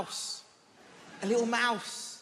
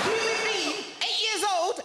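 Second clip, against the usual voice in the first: urgent pleading; 7 semitones higher than usual. Retold or imitated. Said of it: "I said".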